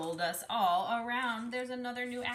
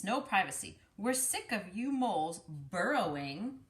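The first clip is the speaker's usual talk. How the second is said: usual speaking voice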